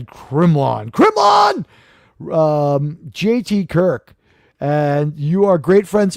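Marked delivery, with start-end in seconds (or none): 0.1-0.9 low, drawn-out name; low-pitched. Pretend. Host naming a fan in the roll call, low-pitched. 0.9-1.6 high, shouted name. Pretend. Host hailing a fan in the roll call, shouted.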